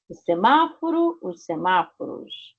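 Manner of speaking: teacher drilling the plural; singular-plural drill. Shown as a demonstration. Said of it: "pra ter o plural".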